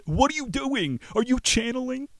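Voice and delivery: high mocking freaked-out voice; friends' disbelief. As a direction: mimic baffled friends. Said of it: "Like my friends".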